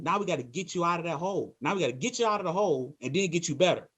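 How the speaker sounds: ordinary talk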